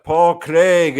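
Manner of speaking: put-on loud, drawn-out Scottish accent; ring-announce it with a Scottish accent. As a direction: boom the name out in a Scottish accent. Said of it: "with your best Scottish accent".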